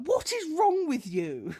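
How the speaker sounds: high, slow, incredulous telling-off; mock-outraged mother; imitated outraged mum. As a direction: stretch it out in high-pitched disbelief. Mum confronts boyfriend, retold.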